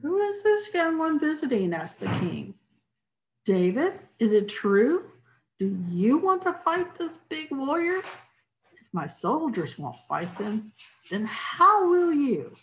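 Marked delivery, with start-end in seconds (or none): 0.0-1.7 king voice. Acted. 3.4-12.6 king voice. Acted. King questions David.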